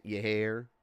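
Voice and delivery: drawled Southern "Ya hair"; Southern accent. Shown as a demonstration. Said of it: "I did it in a southern accent".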